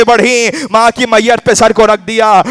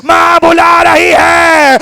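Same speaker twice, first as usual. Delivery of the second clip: anguished call; 6 semitones higher, much slower than usual. Acted. Zahra calling Husain from shroud.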